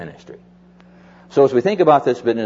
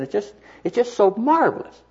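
higher-pitched, mildly gushing wonder; retold elation; 9 semitones higher than usual. Delighted friend praises his new church, retold elation. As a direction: imitate a friend walking on air. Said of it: "he was just walking on air".

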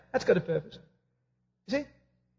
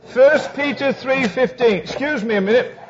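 ordinary talk

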